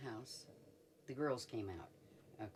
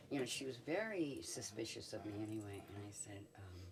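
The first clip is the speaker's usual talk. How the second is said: normal talking voice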